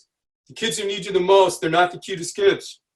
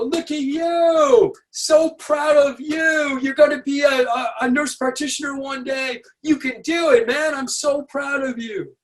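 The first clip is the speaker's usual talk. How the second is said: over-the-top cheerleading; 9 semitones higher than usual. Retold or imitated. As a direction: cheer the kid on like a proud fan, hammed up. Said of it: "My natural predilection is to go".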